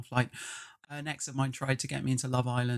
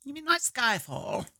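Dowager Duchess voice; 9 semitones higher than usual. Acted. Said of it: "I can't turn the Dowager Duchess voice off now".

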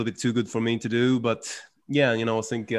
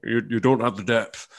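lightly voiced Coogan jibe; a hint of Coogan scolding; imitating Coogan; 2 semitones lower than usual. Imitating Coogan mocking Brydon's impression, lightly voiced. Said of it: "He's always like".

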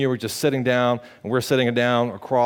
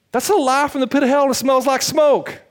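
high, loud preaching shout; fiery shout; 14 semitones higher, 6 dB louder than usual. Acted. Pastor calling out his listeners' excuse, shouting. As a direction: blast the lie away.

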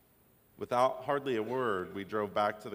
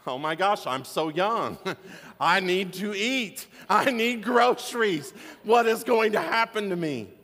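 imitating a scared kid wailing, higher and much louder; retold panicked crying; 9 semitones higher, 9 dB louder than usual. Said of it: "As I'm crying harder".

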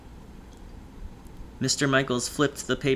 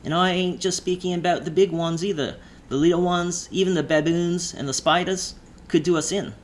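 higher voice, ominous warning; lightly voiced driver, creeping menace; menacing drawl; 5 semitones higher than usual. A narrator's reading. Driver warning about small deadly creatures, drawled. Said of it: "the drawl speak".

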